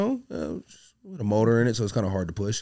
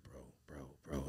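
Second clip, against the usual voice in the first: low hushed repeated bro; deep brother voice; 8 semitones lower, 24 dB quieter than usual. Retold or imitated. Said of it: "So he's like".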